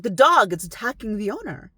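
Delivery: shouted alarm, the first two words hit hard. Retold or imitated. Sister bursting into the bedroom, shouting in alarm. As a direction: burst in yelling about the dog. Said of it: "And she's like".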